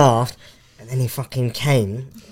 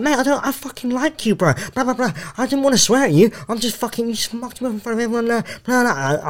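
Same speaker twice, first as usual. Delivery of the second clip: heated retort; 9 semitones higher, 3 dB quieter than usual. Retold or imitated. Retelling his confrontation.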